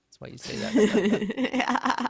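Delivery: high, slow and loud; silly drawn-out voice. Pretend. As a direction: stretch it out like a goofy cartoon. Teasing after the chat message.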